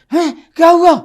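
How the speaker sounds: high, loud jolted-awake yelp; startled husband. Acted. Husband woken by his moaning wife, startled loud yelp. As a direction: jump awake in alarm.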